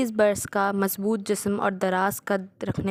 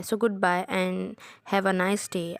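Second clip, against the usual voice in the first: very slow; much slower than usual. Acted. Curt dismissive farewell.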